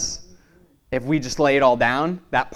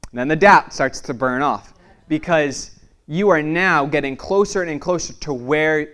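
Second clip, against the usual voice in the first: preaching; 4 semitones higher, 3 dB louder than usual. Acted. Rising preaching on burning off.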